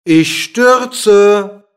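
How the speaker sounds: loud, high, drawn-out cry; calling out. A narrator's reading. Dwarf pretending to plunge down, calling out. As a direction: draw the word out like a mock falling call. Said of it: "rief der Zwerg".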